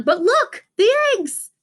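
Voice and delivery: high, rising alarmed cry; alarmed shout. A narrator's reading. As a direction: startle at the stolen eggs. Don spots the thief.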